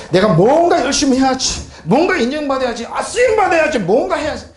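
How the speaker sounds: high, pushed imitation of anxious self-talk; mock striving. Preacher mimics believer chasing recognition, mock striving.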